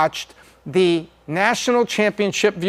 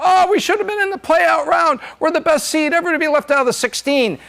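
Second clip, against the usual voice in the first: whiny team complaint; 9 semitones higher than usual. Retold or imitated. Mocking a team left out.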